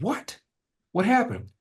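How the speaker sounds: high, startled what; jolted by the news; shocked. Retold or imitated. Asking his dad, high startled surprise. Shock at seven-year marriage.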